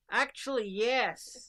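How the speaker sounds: loud, high, punched reply; retold answer, boldly declared; emphatic retort. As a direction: fire it back loudly and emphatically. Retold defiant answer to border agent.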